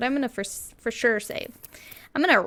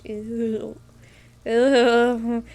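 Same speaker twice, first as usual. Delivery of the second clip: grossed-out; 5 dB louder than usual. Real, not acted. Grossed out by description.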